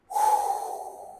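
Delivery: big audible deep breath. Shown as a demonstration. Coach showing breath before speech, deep breath. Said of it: "You take a deep breath".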